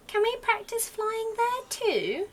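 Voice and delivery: high, pleading little chick; baby owl asking mother hopefully. Acted. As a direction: lift it way up like a small child asking. Little Owl voiced by narrator.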